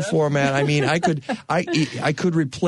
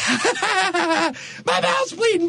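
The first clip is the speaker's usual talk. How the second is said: much higher and slow, laughing through the words; laughing in wonder; much slower than usual. Acted. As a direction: laugh it out in joyful disbelief. George overjoyed he is alive.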